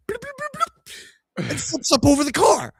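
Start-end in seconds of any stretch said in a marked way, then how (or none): 0.1-1.5 high silly bloops climbing up; bloop sound effects. Retold or imitated. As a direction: pop out cartoon bloops. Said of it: "the driver's side one goes".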